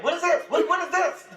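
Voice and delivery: high, incredulous mock outrage. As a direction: act out being scandalized. Got mad at porn video.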